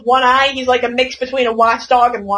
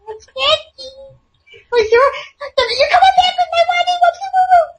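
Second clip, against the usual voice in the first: shrill whiny cartoon voice; high cartoon voice; 3 dB louder than usual. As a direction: squeak it like a cartoon. Playful cartoon voice banter.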